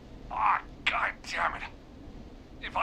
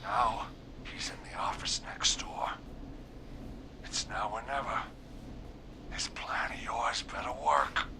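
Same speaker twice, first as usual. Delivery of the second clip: tense whisper, much softer; 9 semitones lower, 8 dB quieter than usual. Real, not acted. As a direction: hush it so the killer can't hear. Hiding, killer next door. (sussurrando tenso)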